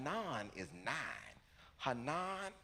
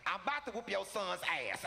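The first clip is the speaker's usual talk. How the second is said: higher-pitched irate aunt; mock angry threat; 7 semitones higher than usual. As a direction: imitate an aunt threatening down the phone. Angry aunt grabs the phone.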